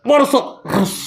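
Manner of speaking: "বর্ষ" then "রস" as separate hammered beats; clipped, separated syllables "বর্ষ, রস"; split syllables. Shown as a demonstration. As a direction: tap each syllable apart like a hammer blow. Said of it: "এক হাতুড়ি দিছি রস".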